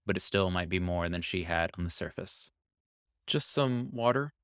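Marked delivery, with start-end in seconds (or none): 3.3-4.3 slow, weak, hoarse request. Acted. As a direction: rasp it out, dry-throated and weary. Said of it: "she said in a hoarse voice".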